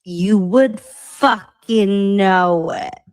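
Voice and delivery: slow, loud, drawn-out dramatic warning; dramatic defiance. Real, not acted. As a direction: lay it on thick, every word weighed.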